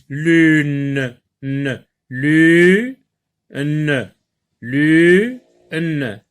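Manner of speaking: loud, stretched "lune" with the n held; drawn-out loud lune. Shown as a demonstration. Teacher modelling "lune" loudly for children. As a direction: sing out "lune" so the final n rings. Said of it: "Écoutez bien ce mot lune".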